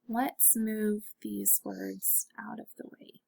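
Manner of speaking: soft whisper to herself while moving things; whispered aside. Real, not acted. Quick aside while moving words.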